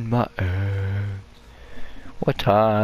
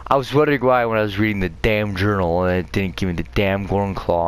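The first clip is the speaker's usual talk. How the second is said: normal talking voice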